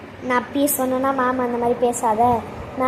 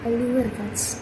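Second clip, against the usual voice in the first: softer, lower voice sharing a secret plan; hushed scheming; 6 semitones lower, 11 dB quieter than usual. Acted. Student telling friend her plan, hushed. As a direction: plot quietly with a friend.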